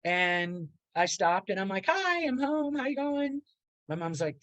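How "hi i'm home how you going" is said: bright, high sing-song hello; cheery greeting. Retold or imitated. Son walking in home, greeting his parents with a cheery high hello. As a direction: breeze in with a chirpy hi.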